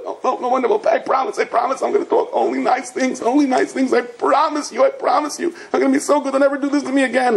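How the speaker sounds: high, frantic begging, mock-terrified; panicked pleading. Frozen parrot begging the owner to let him out, panicked pleading. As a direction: babble promises in a high-pitched panic. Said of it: "the parrot is freezing".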